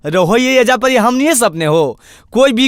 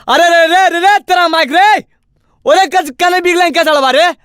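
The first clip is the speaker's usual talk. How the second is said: higher-pitched, indignant calling out; alarmed scolding call; 5 semitones higher than usual. Acted. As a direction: call out to stop them in the act. Catching someone dumping garbage. (टोकते हुए ऊँचा हाँक)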